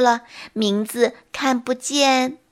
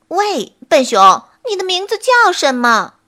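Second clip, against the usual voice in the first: louder, higher, perky monkey call; a smart little monkey with an idea; monkey calling to the bear, bright and loud; clever monkey asking; 6 semitones higher, 6 dB louder than usual. A narrator's reading. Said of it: "小猴很聪明地问".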